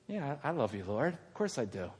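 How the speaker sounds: soft, low, slightly halting reply; subdued assurance. Acted. Peter first answering Jesus about love, subdued. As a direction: answer quietly, a little hesitant.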